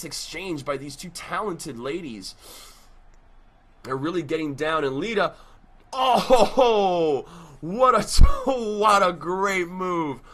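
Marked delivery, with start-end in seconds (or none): 5.9-10.2 ho ho, laughing, louder and higher; laughing excited shout. Acted. Laughing excited play-by-play call.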